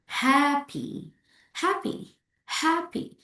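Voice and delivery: HAPPY stressed on HAP, high pitch; first-syllable stress. Shown as a demonstration. Teacher models word stress for learners, stress on HAP. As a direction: punch HAP in HAPPY, let -py drop. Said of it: "you put the stress on the first syllables".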